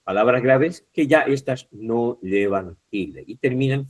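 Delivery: ordinary talk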